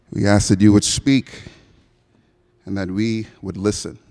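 low-pitched, measured public prayer; solemn prayer. Real, not acted. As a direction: pray low and unhurried, pausing between phrases. Leads the congregation in prayer.

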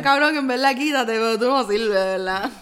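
loud scornful yell; mocking shout. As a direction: shout it at the fighter. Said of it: "Sí, sí, diablo". (gritando con burla)

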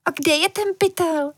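put-on high child's voice, anxious; anxious little girl. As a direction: ask urgently like a worried girl. Girl anxiously asks about the bag. (vysoký, úzkostný dívčí hlas)

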